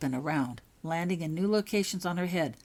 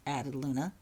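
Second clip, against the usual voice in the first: ordinary delivery, nothing marked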